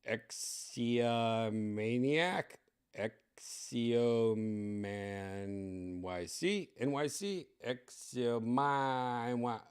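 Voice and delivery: very slow, drawn-out syllables; haltingly guessing at a pronunciation; unsure sounding out. Real, not acted. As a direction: stretch out each syllable as you puzzle over an unfamiliar name. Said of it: "don't know how to say his name".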